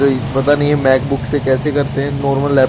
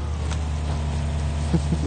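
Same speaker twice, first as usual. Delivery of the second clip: quiet breathy laugh; 8 dB quieter than usual. Real, not acted. Laughs at own mistake.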